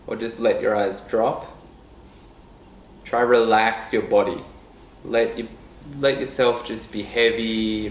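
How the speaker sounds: calm meditation guide with long, unhurried pauses; guided meditation. Real, not acted. Leading listeners to notice breath and body, guided meditation voice. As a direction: ease them softly into stillness, letting phrases trail down. We're gonna go into a bit of a meditation right now.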